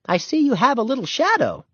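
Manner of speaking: high, friendly grown-up voice with a smile; smiling customer. A narrator's reading. Customer teasing Henry about his tagalong, smiling. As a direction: greet the boy warmly, amused. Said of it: "said with a smile".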